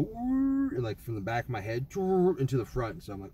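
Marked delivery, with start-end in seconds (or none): 0.0-0.7 a rising mechanical whirr, sung out high; high-pitched whirr. Retold or imitated. Telling friends about the noise in his head, a high imitated whirr. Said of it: "I heard this high-pitched noise go". 1.9-2.4 a short high whirr sliding down; high-pitched whirr. Retold or imitated. Telling friends about the noise in his head, a high imitated whirr. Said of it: "I heard this high-pitched noise go".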